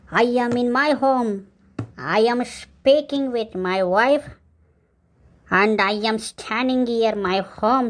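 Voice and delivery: very slow, drawn-out broken English; drunk broken English. Acted. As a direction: lecture the neighbour in slow, pompous bad English. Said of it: "ಯಾವ್ದಾದ್ರು English ನಾವು ಹೇಳ್ದಪ್ಪ".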